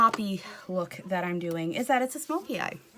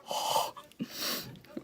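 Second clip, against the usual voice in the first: shocked gasp; 5 dB quieter than usual. Real, not acted. Reacting to the mirror.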